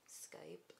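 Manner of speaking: tiny breathy whisper after a laugh; voiceless self-mocking whisper; whispered aside. Real, not acted. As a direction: mutter it under your breath.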